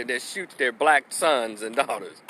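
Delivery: ordinary talk, nothing marked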